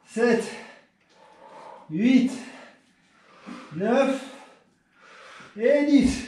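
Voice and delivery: counting reps mid push up; doing push ups. Real, not acted. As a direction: count each rep while working. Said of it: "Position pour les pompes cassées".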